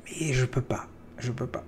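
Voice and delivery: hushed helpless whisper. Acted. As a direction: breathe it out, barely voiced. Confessing helplessness.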